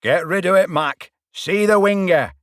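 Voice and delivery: loud, high shouting from the stands; crowd yell. A narrator's reading. Spectators yelling at the winger, loud shout. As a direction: bellow instructions at the player.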